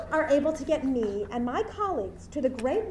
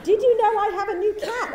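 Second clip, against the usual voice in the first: laughing quote; 9 semitones higher, 8 dB louder than usual. Retold or imitated. Playful quoted line, laughing.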